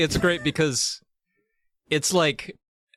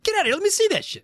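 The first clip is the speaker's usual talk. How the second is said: squealing amazed crew; faster than usual. Retold or imitated.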